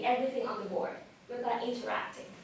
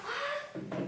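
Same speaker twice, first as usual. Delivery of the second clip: tiny high-pitched squeak of disbelief; soft, very high mock gasp of surprise; shocked; 14 semitones higher, 8 dB quieter than usual. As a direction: squeak it out, stunned. Her shocked reaction, retold.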